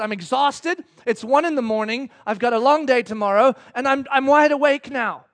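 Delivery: acted fed-up complaint; mad. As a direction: list grievances crossly to a spouse. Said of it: "and I'm mad".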